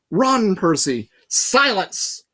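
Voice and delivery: loud, high, drawn-out warning; shouted. A narrator's reading. Annabeth shouting at Percy to run. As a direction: shout a warning across the garden. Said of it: "Annabeth's voice shouted".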